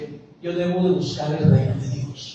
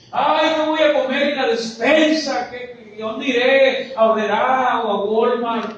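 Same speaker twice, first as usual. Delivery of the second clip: high, hammed-up anxious questions; exaggerated worried wail; mock fretting; 6 semitones higher than usual. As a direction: play a worrier overwhelmed by small errands. Mimics a worrier planning the shopping.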